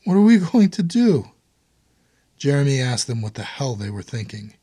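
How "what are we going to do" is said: distraught, high and loud wail; sobbing cry. A narrator's reading. Emily to her husband and his brother, found out, sobbing. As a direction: cry out in despair, pitch leaping up. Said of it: "Emily sobbed".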